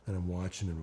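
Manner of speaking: usual speaking voice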